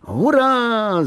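high, drawn-out hooray; whooping high with joy; triumphant shout. A narrator's reading. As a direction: cry out in victory. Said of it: "zakřičel tak, že maminka málem vypadla z okna".

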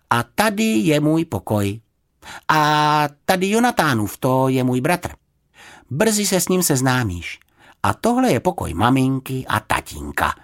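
high, lightly voiced girl; welcoming young guide; girl giving a tour. A narrator's reading.

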